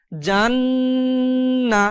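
জান্না with the ন্ন hummed long through the nose; held nasal ghunnah. Shown as a demonstration. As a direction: stretch the nasal hum in জান্না. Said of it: "নাকের বাঁশি হতে একটা গুনগুন আওয়াজ".

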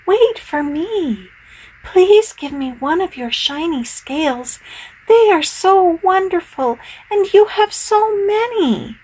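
high, pleading little fish. Acted. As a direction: beg sweetly, high and eager.

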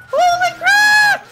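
high shriek into a slow loud curse; shriek, then draw out the curse; frustrated yell. Real, not acted. Player losing a battle, loud frustrated yell.

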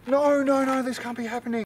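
very high-pitched, hammed-up panic; panicked denial. Uncle Barry after hitting Brad Pitt, high-pitched panic. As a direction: wail it in disbelief, pitched right up.

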